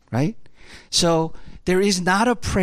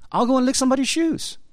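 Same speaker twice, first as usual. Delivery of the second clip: much higher, fast, eager "I'll go and lick somebody's shoes"; eager surrender; 11 semitones higher, much faster than usual. Acted. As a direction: blurt it out high and fast, ready to obey. Eager answer of willing surrender.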